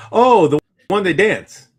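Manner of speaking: normal talking voice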